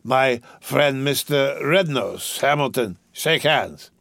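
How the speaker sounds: put-on slurred, giggly drunk rich boy. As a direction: play a rowdy drunk young gentleman. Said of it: "I'm drunk".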